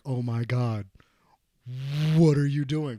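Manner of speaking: loud imitation of an appalled teacher; exasperated mock shout. Teacher to struggling student mid-song, loud mock exasperation. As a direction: play the teacher bursting out in disbelief.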